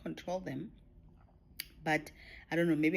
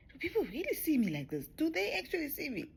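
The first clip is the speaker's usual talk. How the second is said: high, incredulous self-quote; amazed disbelief; 11 semitones higher, faster than usual. Retold or imitated. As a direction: act out wide-eyed wonder, pitch climbing high. Retelling her amazed reaction to herself.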